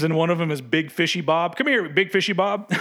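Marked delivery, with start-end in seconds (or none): 1.6-2.7 higher mock American accent, calling a kid; imitating American guy. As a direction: call the kid over like a comedy-bit American dad. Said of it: "the impression of the guy going".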